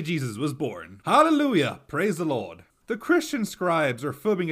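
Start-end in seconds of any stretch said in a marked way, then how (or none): 1.0-2.6 mock hallelujah. Mock celebrating Jesus' birth.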